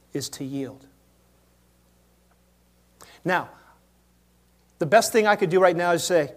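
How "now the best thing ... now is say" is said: ordinary talk, nothing marked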